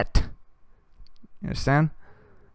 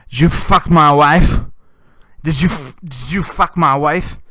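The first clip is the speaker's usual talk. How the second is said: loud, slow, higher accusing roar; furious accusation; 5 semitones higher, 10 dB louder than usual. Retold or imitated. Husband accusing the young man at the bar, loud slow fury. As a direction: hammer the accusation slowly.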